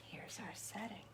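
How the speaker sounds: soft unvoiced whisper. Reader whispering to the kids as the monster is done. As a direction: wind down in a whisper.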